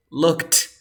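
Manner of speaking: "looked" ending in a loud, released T; final T in looked. Shown as a demonstration. Teacher repeating the first one, "looked" with strong T. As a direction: punch the T at the end of "looked". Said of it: "voy a pronunciar con la T y con la D".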